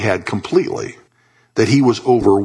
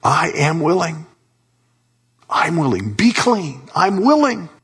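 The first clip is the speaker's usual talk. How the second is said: eager excitement, pitch climbing toward the end; voicing keen willingness, rising as it goes; Jesus, excited; 8 semitones higher than usual. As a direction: build from a willing assurance to a high, glad command. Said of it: "You can actually hear the excitement in Jesus' voice".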